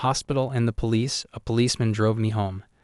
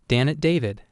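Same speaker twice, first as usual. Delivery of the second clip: ordinary delivery, nothing marked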